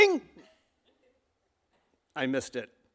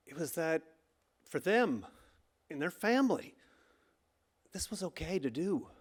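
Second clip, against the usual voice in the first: much softer, quiet and stunned; hurt; 8 dB quieter than usual. Real, not acted. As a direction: let the hurt sink the voice. Painful memory, voice drops.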